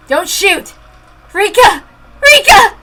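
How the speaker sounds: high desperate shouts building; desperate cries. Acted. Girl begging the gunmen to spare friend, desperate cries. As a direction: cry out to stop them.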